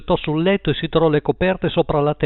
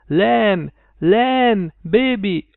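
high, drawn-out calling cry; shouting; 7 semitones higher, 3 dB louder, much slower than usual. A narrator's reading. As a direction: call out as if from afar, pitched high. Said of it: "gridando con tutto il fiato". (richiamo gridato, acuto)

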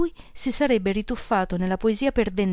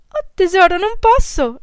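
much higher, louder sweet brush-off; young girl's voice; 11 semitones higher, 9 dB louder than usual. A narrator's reading. Girl turning down Jocelyn on the phone, much higher and louder, cooing. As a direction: coo a cheerful refusal.

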